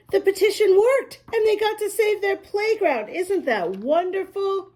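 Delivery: put-on gleeful read-aloud to kids; excited. A narrator's reading. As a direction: share the good news with glee. Excited storyteller to children.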